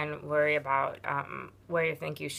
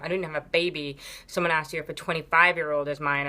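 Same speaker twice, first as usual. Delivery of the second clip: ordinary talk, nothing marked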